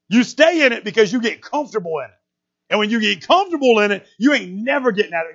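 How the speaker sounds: loud, high, driving preacher's voice; fiery preaching. Acted. Preacher pressing the congregation on self-pity, loud and driving. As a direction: preach it hard and straight to them.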